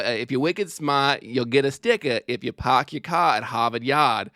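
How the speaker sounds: practised Boston accent, dropping the R's. Shown as a demonstration. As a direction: recite it like a Boston accent drill. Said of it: "How to do a Boston accent".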